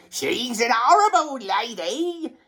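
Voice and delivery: high, swooping silly voice; mock the character in a squawk; exaggerated high-pitched disgust; put-on comic voice. Jokey put-on aside about the character.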